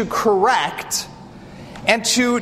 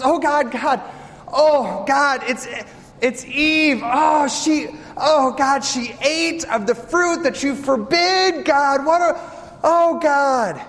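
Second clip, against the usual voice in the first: frantic Adam; 5 semitones higher, slower than usual. Acted. Adam frantically reporting Eve to God.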